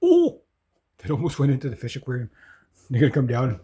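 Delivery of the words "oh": surprised. Real, not acted. Something almost falls in aquarium.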